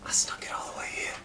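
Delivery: breathless conspiratorial whisper. Acted. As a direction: whisper like you're hiding a smuggled drink. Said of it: "And he goes".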